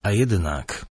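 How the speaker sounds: cool, detached reply; coldly. A narrator's reading. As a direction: answer with icy calm. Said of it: "odpowiedział chłodno Abel".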